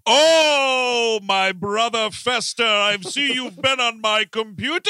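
hammed-up theatrical lament; Gomez Addams. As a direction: declaim like a flamboyant melodrama patriarch. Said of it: "he's only doing Gomez Addams in this".

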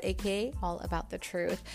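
usual speaking voice

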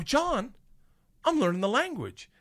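high-pitched, excited announcement; excited boast. Retold or imitated. Boasting of new words in retold scene.

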